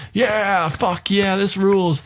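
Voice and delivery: high, hyped-up crowd cheer; mock bloodthirsty crowd yelling. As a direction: whoop it like a rowdy fan. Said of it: "They're like".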